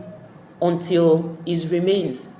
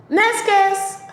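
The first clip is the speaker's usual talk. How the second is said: curt dismissal; 11 semitones higher, 5 dB louder than usual. Retold or imitated. King dismissing the case.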